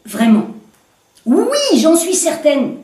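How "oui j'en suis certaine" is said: higher, insistent complainant arguing her case; woman protesting to the commissaire; indignant woman. Acted. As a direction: press the point, voice raised in pitch.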